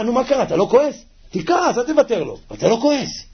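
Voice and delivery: high-pitched, incredulous questioning; amazed self-talk. Acted. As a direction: goad yourself in disbelief. Acted astonished self-talk.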